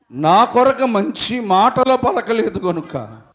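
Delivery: loud, high fervent sermon voice; full-force preaching. Acted. Preacher retelling Job's friends to congregation, fervent. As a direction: preach it out with fire.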